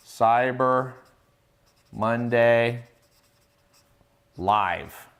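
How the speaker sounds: word by word with long pauses; one word at a time, widely spaced; dictating. Real, not acted. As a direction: dictate it slowly, as if writing each word down. Spelling out link while writing.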